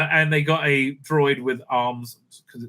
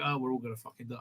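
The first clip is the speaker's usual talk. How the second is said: mumbled mock despair; 4 semitones lower, 13 dB quieter, much faster than usual.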